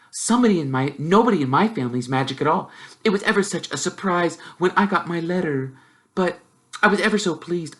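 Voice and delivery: put-on rattling know-it-all girl; very fast. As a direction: show off in one breath, brightly. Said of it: "She said all this very fast".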